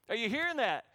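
ordinary talk

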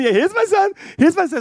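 high-pitched, eagerly presenting a son; eager proud father. Acted. Jesse presenting his sons to Samuel, eager and proud.

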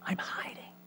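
very faint, hidden whisper; ashamed whisper. Acted. Ashamed child confesses to God, whispering. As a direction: whisper it as if hiding.

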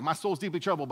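badly faked New York accent. Pastor imagines Jesus as a New Yorker, hammed-up New York accent. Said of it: "like, a New Yorker Jesus".

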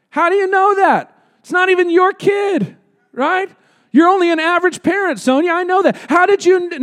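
high, teasing mock challenge to a congregant; mock accusing tease. Real, not acted. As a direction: needle her playfully, eyebrows up. Teasing a parent in the crowd.

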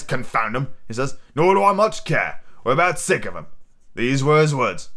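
pirate leader recounting a parley; Long John Silver. Acted. Silver reporting the doctor's words to Jim, pirate role. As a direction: tell it as an old sea rogue.

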